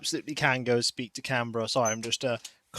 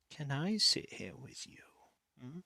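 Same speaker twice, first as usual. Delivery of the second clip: whisper; 11 dB quieter than usual. Real, not acted. Whispering to the cat.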